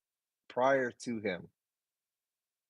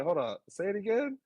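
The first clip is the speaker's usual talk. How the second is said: stunned request; 10 semitones higher than usual. Retold or imitated. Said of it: "I was like".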